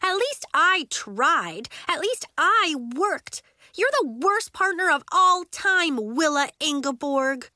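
put-on furious child laying blame; stomping anger. A narrator's reading. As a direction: hammer each accusation home. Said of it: "Nori stomped".

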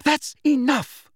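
high, loud, forceful outburst; forceful scolding exclamation. A narrator's reading. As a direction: put a firm stop to it, high and loud. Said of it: "Hermione said forcefully".

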